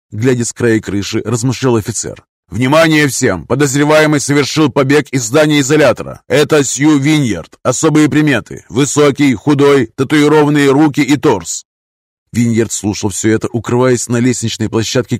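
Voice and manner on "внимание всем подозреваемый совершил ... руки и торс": loud, raised police radio call; radio announcement. Acted. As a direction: bark it like a radio bulletin.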